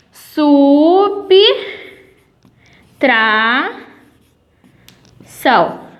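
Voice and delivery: subtração split slowly as "sub-tra-ção", b closing the first syllable; drawn-out syllable-by-syllable subtração; syllable-split demo. Shown as a demonstration. Teacher to class, spelling out syllables very slowly. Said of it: "ela sempre vai ficar na sílaba anterior".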